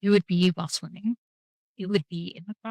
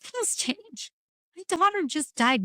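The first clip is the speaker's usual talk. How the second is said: higher-pitched, pleading retort; retold protest; 9 semitones higher, slower than usual. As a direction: quote yourself protesting, voice lifted. Retelling her strained reply.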